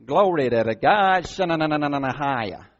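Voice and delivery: hammed-up speaking in tongues, opening loud; Brother Barry. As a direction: parody a frenzied YouTube preacher bursting into tongues. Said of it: "YouTube, Brother Barry".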